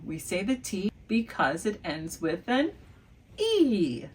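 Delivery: ordinary talk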